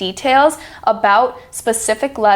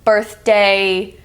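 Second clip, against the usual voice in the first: usual speaking voice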